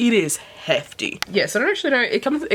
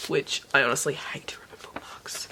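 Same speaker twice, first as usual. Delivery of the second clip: whispered; 3 semitones lower, 8 dB quieter than usual. Real, not acted. Complaint whispered aside.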